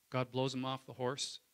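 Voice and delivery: normal talking voice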